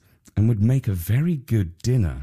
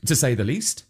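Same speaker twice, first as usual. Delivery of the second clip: plain reading voice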